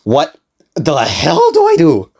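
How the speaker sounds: slow, high, hammed-up despair; drawn-out mock panic. Narrator faced with drives and no money, drawn-out mock panic. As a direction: stretch it out, climbing in exaggerated alarm.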